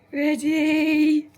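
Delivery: excited call. Real, not acted. Readying the puppy for fetch.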